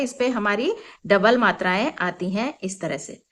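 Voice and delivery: ordinary delivery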